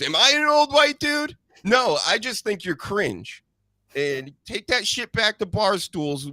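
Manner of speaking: high, heated rant; indignant high-pitched venting; blowing off steam. Real, not acted. Host telling off cringe media personalities, fed up and heated. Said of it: "I gotta blow off some steam".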